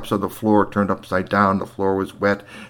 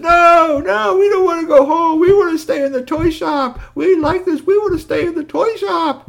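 put-on whining kids; 5 dB louder than usual. Kids pleading to stay.

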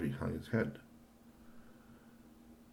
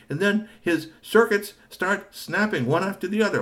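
high excited inventor; 12 semitones higher, 9 dB louder than usual. Acted. Robot maker explains high-voiced.

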